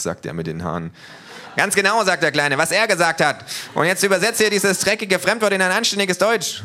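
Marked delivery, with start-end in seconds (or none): 1.6-2.6 high, loud triumphant yell; little thug loudly backs up his boss; loud agreement. Acted. 2.6-6.6 high, loud bossy order; little thug yells a demand. Acted.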